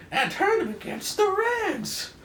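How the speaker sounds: put-on very high, loud excited kid voice; squeaky sidekick. Rick Jones cheering the doctor, in a very high, loud squeaky voice. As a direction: squeal it like an eager boy sidekick.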